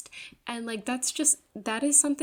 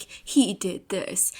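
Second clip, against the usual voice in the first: slow, louder mock-dramatic accusation; 5 dB louder, much slower than usual. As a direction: drag it out like a melodrama heroine. Heroine accusing kidnapper before gods.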